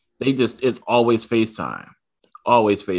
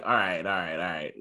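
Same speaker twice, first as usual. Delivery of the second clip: quiet resigned; 5 semitones lower, 8 dB quieter than usual. Retold or imitated. Dad backing off quietly.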